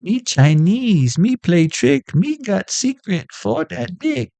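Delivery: mock rhyme. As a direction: chant the silly rhyme in a put-on voice.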